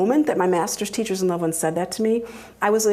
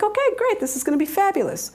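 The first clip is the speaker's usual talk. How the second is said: high, bright eager reply; high thrilled okay; excited; 12 semitones higher, faster than usual. Retold or imitated. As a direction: gush it out high and quick. Responding to helper's offer.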